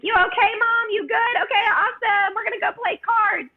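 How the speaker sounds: high, chirpy mimicry of breezy teens; bouncy carefree daughters. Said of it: "they just kind of bounced away".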